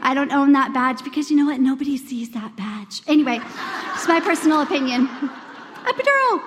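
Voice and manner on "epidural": much higher, a bit louder punchline; higher, emphatic. Real, not acted. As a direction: land the word like a triumphant answer. Her punchline answer.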